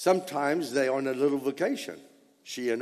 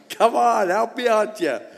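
normal talking voice

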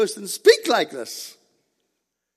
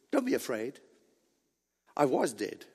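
softer, low-pitched comforting words; gentle reassurance; 7 semitones lower, 7 dB quieter than usual. Acted. Preacher voices Christ comforting the beloved John, gentle reassurance. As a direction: reassure softly, voice well down.

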